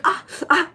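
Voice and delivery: short high cries of pain; pained yelps. Retold or imitated. Client yelping at the waxer's painful strip, high. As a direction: yelp as the strip rips.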